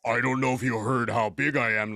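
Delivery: extremely deep bass voice. Pretend. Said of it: "In the deepest voice you could find".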